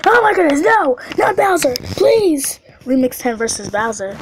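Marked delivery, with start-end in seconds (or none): 0.1-2.6 high, loud cry of dread; dismayed pleading. Real, not acted. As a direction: beg loudly as the boss appears. Dreads the boss appearing.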